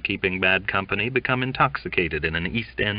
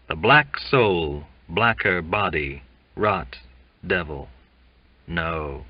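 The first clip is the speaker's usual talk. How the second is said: plain reading voice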